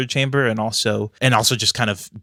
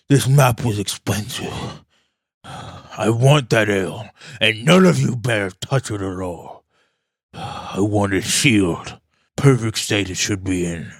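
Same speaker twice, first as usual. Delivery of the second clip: grumpy dwarf king. Acted. King demands sealed ale.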